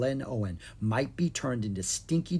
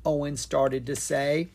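plain reading voice

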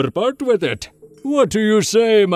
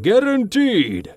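high booming close with a falling tune; 12 semitones higher, slower than usual. Acted. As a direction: boom the word home like a huckster. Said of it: "he boomed".

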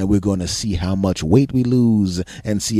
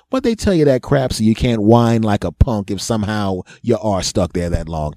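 ordinary delivery

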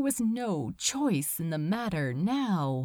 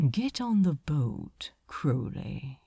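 sneering demon; 6 semitones lower than usual. Acted. Asmodeus mocking the pair, low and sneering. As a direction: purr it with mock politeness. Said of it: "Asmodeus ordered again".

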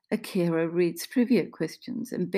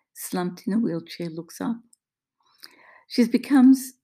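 ordinary delivery, nothing marked

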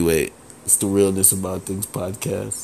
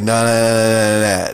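loud rapid nah nah nah; mock dismissive nahs; 7 dB louder than usual. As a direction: wave him off, loud and quick. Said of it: "They was like".

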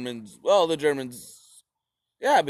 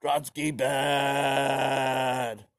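mock Stalin caveman; much slower than usual. Stalin dismissing his generals, slow and dumb. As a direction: drag it out like a brute. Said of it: "And Stalin was like".

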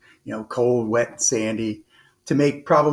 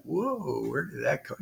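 a hushed, softer stunned whoa, lightly retold; 2 semitones higher, 9 dB quieter than usual. Trainee hearing the proctor knew, softer hushed surprise. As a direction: murmur it softer, caught off guard.

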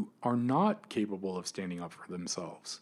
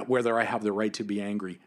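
normal talking voice

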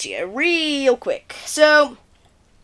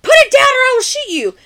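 high, loud mock yell; retold frantic threat; 13 semitones higher, 6 dB louder than usual. Host voices Ashley coming out with the pistol, mock frantic yell. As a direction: act out a desperate armed warning.

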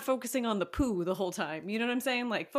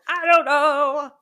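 high silly; 7 semitones higher, 11 dB louder, much slower than usual. Acted. Repeating own words playfully.

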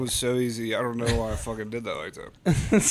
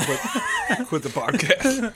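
laughing; 9 semitones higher, much slower than usual. Real, not acted. Joking after wasabi punishment.

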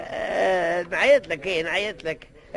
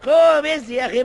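a louder, higher push to get up; loud insistence; 4 semitones higher, 6 dB louder than usual. Acted. Old man loudly insisting the sleeper leave the bench. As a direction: insist loudly, losing patience.

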